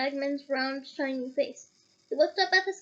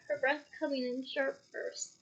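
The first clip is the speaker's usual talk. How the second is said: ordinary delivery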